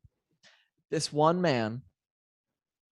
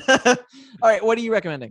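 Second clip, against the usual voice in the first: higher and louder, still laughing; laughing handoff; 8 semitones higher, 7 dB louder, faster than usual. Real, not acted. Passing the turn to her co-host while laughing. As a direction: toss the question over through a laugh.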